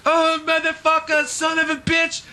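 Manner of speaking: a high mock yell of a furious kid; imitating the kid's rage. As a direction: mimic a nine-year-old losing it. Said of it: "you hear in the background".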